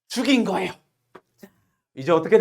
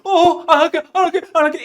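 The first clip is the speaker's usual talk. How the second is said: high, louder panicked promising; desperate pleading; 8 semitones higher, 6 dB louder, faster than usual. Retold or imitated. Boy begging the girl to spare him, high panicked pleading. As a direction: beg to be spared. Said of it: "이렇게 되는 거예요".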